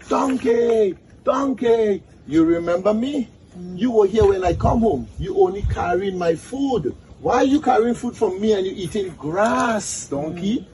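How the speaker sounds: high-pitched little piglet whining at a donkey; complaining piglet. Acted. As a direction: call out high, then pester him with questions.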